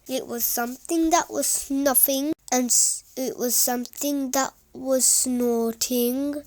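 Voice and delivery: usual speaking voice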